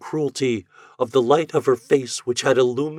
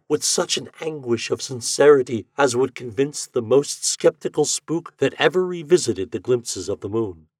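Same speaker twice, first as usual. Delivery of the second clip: ordinary delivery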